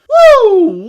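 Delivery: a sudden loud, high whoop of disbelief; loud woo. Real, not acted. Reviewer explodes at the script writers, loud high whoop. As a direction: let out an exasperated whoop.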